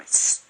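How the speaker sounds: S hissed as a tight voiceless s; a tight closed hiss for S; closed s sound. Shown as a demonstration. Teacher repeats the closed S sound for the student, hissed. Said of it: "C'est assez fermé".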